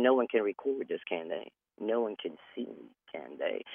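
hushed, nervous, barely audible; a scared stoned hush; paranoid hush. Retold or imitated. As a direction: hush it like someone might be listening. Paranoid high quote, hiding.

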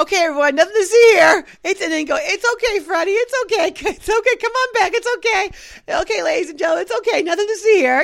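sing-song coaxing, like calming a stray pet; older man chasing the streaker and calming the crowd, high coaxing voice; coaxing bystander. Retold or imitated.

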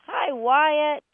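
high, loud playful hello; cheery greeting. Pretend. Host turns the phone number into a hello, cheery call. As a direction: call it out like greeting a favorite dog.

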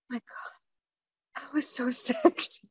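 hushed, awed whisper with a laugh; breathy whisper. Retold or imitated. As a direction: whisper it like a swooning secret. Aroused reaction to husband.

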